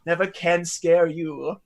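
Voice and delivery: slow, drawn-out theatrical lilt; teasing mentor figure. Acted. Mentor teasing his unshakeable young pupil, slow mock lament.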